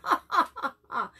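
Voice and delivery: loud high ha ha ha laugh; mocking laugh. A disruptive boy laughing out loud at the teacher.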